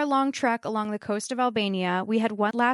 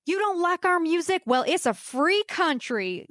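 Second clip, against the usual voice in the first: higher mock yell, slowing down defiantly; hammed-up defiant shout, higher; mock scream; 8 semitones higher than usual. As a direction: yell it back like a cocky boater. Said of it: "Logan screamed".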